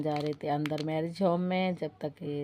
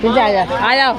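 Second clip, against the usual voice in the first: loud, raised-pitch call; calling someone over; 4 semitones higher, 14 dB louder than usual. Real, not acted. Calling Prince over at a noisy wedding, calling out. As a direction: call out to bring them over.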